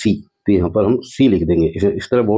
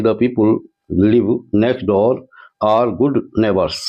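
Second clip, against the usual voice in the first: slow dictation read; much slower than usual. Shown as a demonstration. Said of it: "reading the question sentence".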